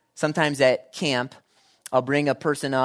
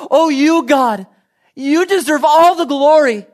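high, loud gushing flattery of God; mock-gushing worshipper heaping praise; 12 dB louder than usual. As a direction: pour it on, syrupy and over the top. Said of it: "when we tell God".

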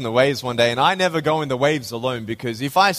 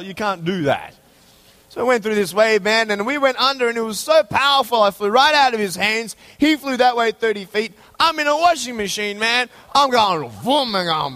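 excited retelling; 11 semitones higher than usual. Retold or imitated. Telling the church about the wave, excited and high. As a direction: relive the wipeout at high speed.

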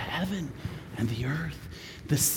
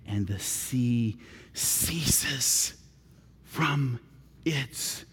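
lower, softer, very slow; hushed and slow; 6 semitones lower, 7 dB quieter, much slower than usual. A narrator's reading. Preacher retells the calm sea, slow and hushed. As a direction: let it fade into stillness.

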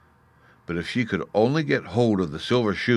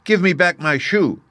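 put-on higher, louder angry girl; raised-voice, angry demand; 9 semitones higher, 5 dB louder than usual. A narrator's reading. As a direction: indignantly demand it back. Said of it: "grew angry and said".

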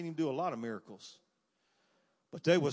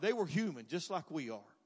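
ordinary talk

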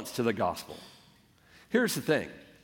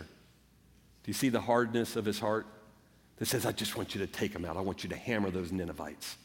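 1 hushed; 7 semitones lower, 8 dB quieter than usual. Real, not acted. Preacher hushed, voicing hard heart.

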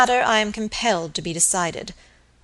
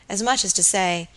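ordinary delivery

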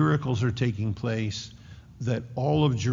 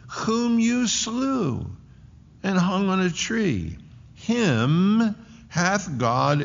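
impassioned preaching; 7 semitones higher than usual. Real, not acted. Preacher voices Peter's speech to the Sanhedrin, high impassioned preaching. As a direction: lift the voice high and stretch the key words.

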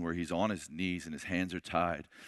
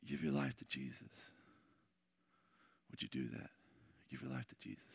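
much softer, tender pleading; soft begging; 6 dB quieter than usual. Retold or imitated. David begging the listener to surrender to Jesus, soft plea. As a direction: quietly plead with them to give their life. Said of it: "David's begging you".

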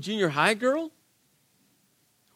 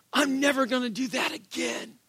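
higher-pitched mock vow; earnest-sounding imitated resolution; acted resolve; 6 semitones higher than usual. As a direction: swear it off, pitched up. Acts out a Sunday resolution.

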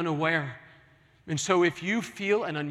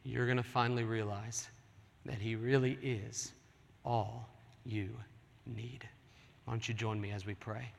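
fading hush; 5 semitones lower, 8 dB quieter than usual. Acted. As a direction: lower and much softer, trailing to near whisper. Sermon climax fades to hush.

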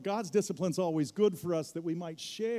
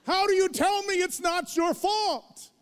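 loud, high, incredulous shout at the son; furious father yelling at his son over the crash; retold angry yell; 11 semitones higher, 7 dB louder than usual. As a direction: let the anger explode, much higher and louder.

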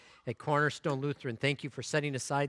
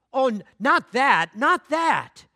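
high, louder, begging not that; terrified pleading; 9 semitones higher, 10 dB louder, slower than usual. Acted. Bamboo begging the master, loud high terrified plea. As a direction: stammer a desperate plea. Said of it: "A trembling of great horror shook bamboo".

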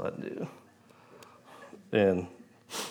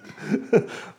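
high breathy laugh; 13 semitones higher than usual. Real, not acted. Laughs at himself crying.

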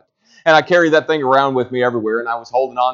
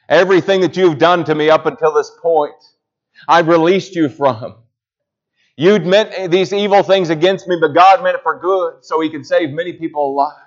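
preached forgiveness; 6 semitones higher, 4 dB louder than usual. Acted. Joseph telling his brothers he has released them, preached loud. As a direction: proclaim the pardon.

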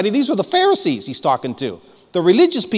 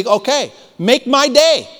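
a taunting, higher, louder "make my day"; defiant dare; 7 semitones higher, 5 dB louder, slower than usual. Pretend. Preacher daring the enemy before the congregation, defiant and loud. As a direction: throw down the challenge like a movie tough guy. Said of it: "We need to say".

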